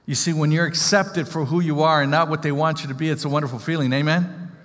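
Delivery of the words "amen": ordinary delivery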